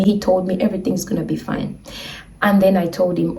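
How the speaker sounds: ordinary delivery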